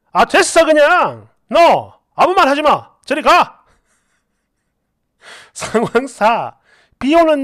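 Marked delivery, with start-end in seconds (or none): 0.1-3.6 loud, exasperated outburst; angry dismissal. Acted. Husband ordering wife to go away, angry dismissal. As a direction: shut the argument down forcefully.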